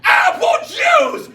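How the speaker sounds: very high, slow mock scream; hammed-up, drawn-out high shriek; aggressive child's demand. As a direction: imitate a toddler screaming each word out long. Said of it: "like, aggressive people".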